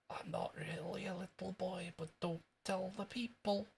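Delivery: high, hushed stage whisper in Scottish; soft, squeaky Scottish secret-telling; Jimmy Krankie. Acted. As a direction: confide it softly in a Scottish boy's voice. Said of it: "That was wee Jimmy Cranky".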